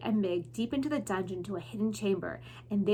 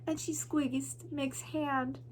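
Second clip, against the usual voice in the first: ordinary delivery, nothing marked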